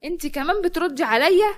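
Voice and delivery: sister-in-law, outraged, lashes out at Zahra; angry scolding. Acted. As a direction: scold her for talking back.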